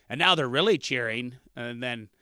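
usual speaking voice

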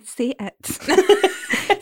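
high-pitched, put-on plea; exasperated urging. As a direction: urge them through mounting exasperation. Silently urging a video speaker.

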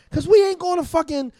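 animated outburst, pitch way up; worked-up rant. Real, not acted. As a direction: get fired up about it. Heated rant against lavish dates.

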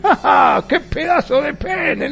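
high, slow gasp of wonder with a laugh; mock amazed woman. Mujer impresionada en el chiste. (asombro fingido, agudo y lento)

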